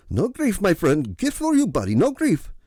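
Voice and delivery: put-on high hurried Middle Eastern accent. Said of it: "he was hurriedly saying".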